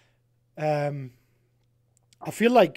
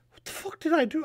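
shocked; 12 semitones higher than usual. Retold or imitated. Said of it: "I was like".

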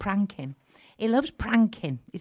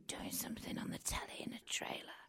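hushed whisper of a quoted question; 20 dB quieter than usual. Retold or imitated. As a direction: whisper it as if someone might overhear. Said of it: "You're whispering".

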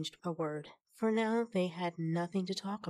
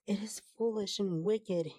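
plain reading voice

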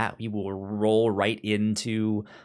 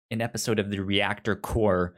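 usual speaking voice

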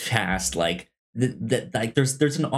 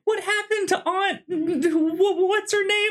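put-on much higher, far-off squeaky child asking; puzzled kid voice. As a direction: play a confused little kid wondering where his aunt went. Confused kid asking about frogs.

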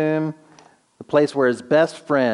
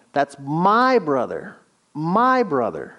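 MY drawn out and pushed higher in "my brother"; emphatic MY; 3 semitones higher, slower than usual. Acted. Mary grieving her brother to Jesus, emphatic stress on MY. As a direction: lean long and hard on "my". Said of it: "is used so emphatically".

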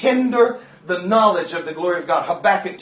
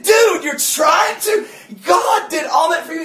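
incredulous; 8 semitones higher than usual. Retold or imitated. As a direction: exclaim in exasperated disbelief. Retold incredulous reply to Chris.

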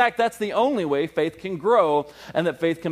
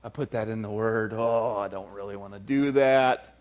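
mock reluctant God; 6 semitones lower, 3 dB quieter than usual. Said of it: "And then he goes".

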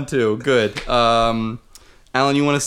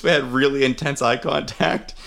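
ordinary talk

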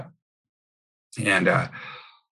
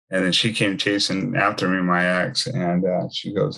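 usual speaking voice

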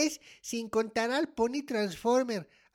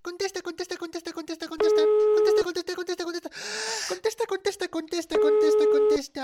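high, rapid-fire chanting that builds; frantic urging; 5 semitones higher, 4 dB louder, much faster than usual. Acted. Child waits for mom to answer the phone, frantic. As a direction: repeat it desperately without letting up.

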